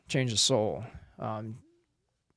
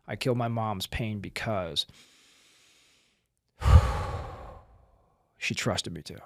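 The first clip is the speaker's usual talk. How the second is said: slow breathwork reading; 2 semitones lower, slower than usual. Shown as a demonstration. Coach showing the last breathwork step, slow breathing read. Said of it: "read it thirty percent slower and breathe".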